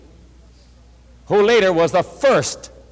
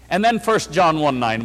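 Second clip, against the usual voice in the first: usual speaking voice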